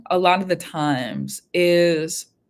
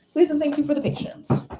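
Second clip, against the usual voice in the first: chirpy, much higher second take; lilting higher thank-you; playful higher repeat; 8 semitones higher than usual. Real, not acted. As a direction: sing it back higher in a light playful voice. Repeats the sign-off line, playfully.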